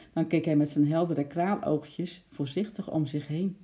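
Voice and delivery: ordinary delivery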